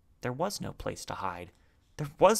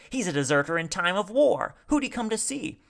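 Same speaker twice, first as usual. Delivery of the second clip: loud, high, agitated call; shouted; 9 semitones higher, 5 dB louder than usual. A narrator's reading. Man demands to know whom the deserter visited, shouted. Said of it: "shouted another".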